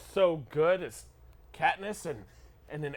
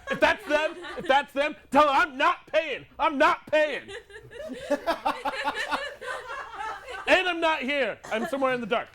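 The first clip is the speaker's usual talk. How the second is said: panicked shouting; 10 semitones higher, 4 dB louder than usual. Acted. Hiding from debt collectors.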